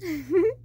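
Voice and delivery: short happy giggle; light laugh. Acted. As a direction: laugh off the thanks. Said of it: "I just laughed".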